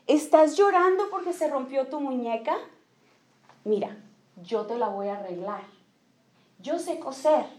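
más suave, tranquilizando a una niña; madre que consuela. Retold or imitated. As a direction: consuela como una mamá cariñosa, bajando la voz. (la mamá le promete a la niña arreglar la muñeca, con voz suave)

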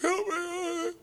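high, small plea for help; weak plea. Retold or imitated. Trapped Eddie answering the boss, high and weak. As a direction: beg faintly from the chimney.

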